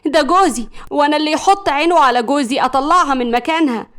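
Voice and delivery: high, loud jealous outburst; loud, furious. A narrator's reading. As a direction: burst in shouting at a rival. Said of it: "بتقول بصوت عالي".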